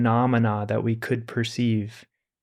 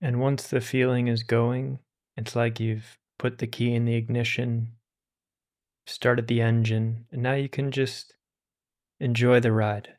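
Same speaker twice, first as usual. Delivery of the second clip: calm, unhurried meditation voice with long pauses; guided meditation; 3 dB quieter than usual. Acted. Teacher guiding listeners through meditation, calm and unhurried. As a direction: ease listeners into stillness.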